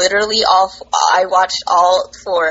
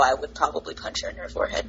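muttered under breath; 5 semitones lower, 11 dB quieter, faster than usual. Real, not acted. Mock threat, said under breath.

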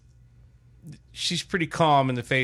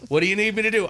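higher-pitched insistent question; retold exchange; 9 semitones higher, 4 dB louder, much faster than usual. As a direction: escalate the mock back-and-forth. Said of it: "we go back and forth like this".

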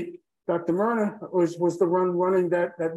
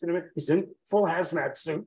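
ordinary talk

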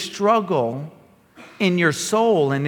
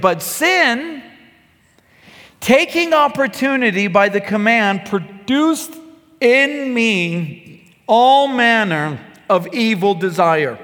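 raised, slow, declaiming read of Romans; preacher reading Scripture to the church, higher and emphatic; 3 semitones higher, slower than usual. Acted. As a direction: proclaim the verse to the congregation. Reading verse eight.

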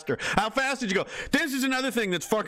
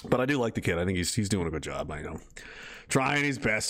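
normal talking voice

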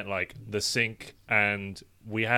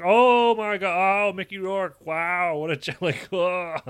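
much higher and louder, slow drawn-out awe; awestruck gushing; 9 semitones higher, 7 dB louder, slower than usual. Retold or imitated. Rewatching The Wrestler and raving, high and slow awe. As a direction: melt in awe at Mickey Rourke.

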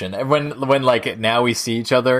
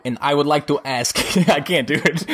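giggly; 3 semitones higher than usual. Real, not acted. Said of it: "He's a little bit giggly".

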